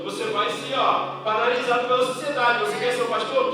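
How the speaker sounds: impassioned pulpit shouting; full-force preaching. Acted. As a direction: preach it out with rising fire to the congregation.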